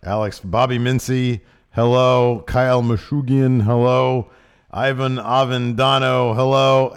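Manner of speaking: loud, booming roll call; announcer. Acted. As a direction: call out every name like an emcee.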